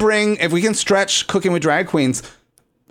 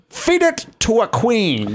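high, slow, grand drag diva; imitating a regal, strangled-sounding queen; Vivacious; 7 semitones higher, slower than usual. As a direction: declaim it slowly, pitched way up. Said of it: "And Vivacious wrote".

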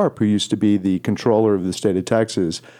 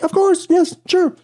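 thrilled yes. Retold or imitated. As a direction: jump at the offer, squealing high. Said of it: "I was like".

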